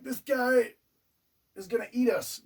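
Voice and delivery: high, loud frantic hiss of fear; panicked warning. Retold or imitated. Husband warning his wife about the huge guy, frantic.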